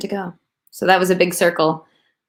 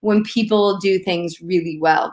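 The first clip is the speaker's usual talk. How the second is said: ordinary talk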